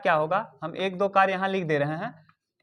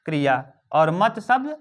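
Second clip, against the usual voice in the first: ordinary talk, nothing marked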